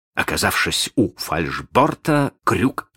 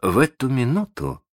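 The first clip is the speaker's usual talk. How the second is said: ordinary delivery, nothing marked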